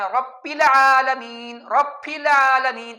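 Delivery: رب said with an English p sound instead of b, slow; teacher mimicking the English p in رب العالمین, slow; English p demo. Shown as a demonstration. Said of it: "English میں جو پی ہے".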